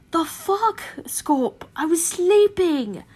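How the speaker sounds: a sleepy, loud groan of protest, slowed. Acted. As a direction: groan it out, just woken. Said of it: "Ted groaned".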